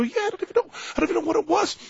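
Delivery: very high-pitched bewildered protest; acted flustered denial. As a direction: play a rattled victim pleading ignorance. Acted victim flustered by friends' questions.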